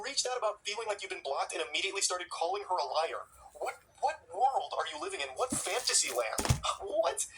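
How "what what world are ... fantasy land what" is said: can't believe what you're reading; incredulous. Real, not acted. Disbelief at his texts.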